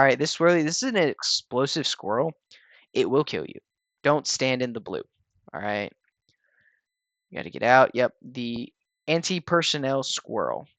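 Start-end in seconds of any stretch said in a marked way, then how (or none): none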